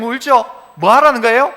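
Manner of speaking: usual speaking voice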